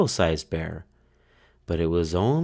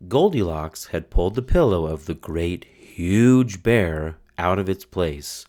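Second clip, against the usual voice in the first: ordinary delivery, nothing marked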